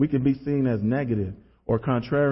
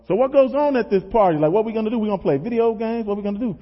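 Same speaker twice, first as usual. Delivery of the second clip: higher, eager string of questions; curious teenager; 5 semitones higher, faster than usual. Retold or imitated. Younger self asks schoolmates about the party, curious. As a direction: ask like a naive kid, pitched up.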